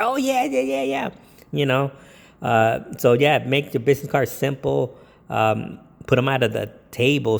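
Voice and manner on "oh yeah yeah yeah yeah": much higher, eager agreeing guest voice; high excited guest gushing yes; happy guest. Retold or imitated. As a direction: play the thrilled partygoer, pitched way up. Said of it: "they'll remember you and say".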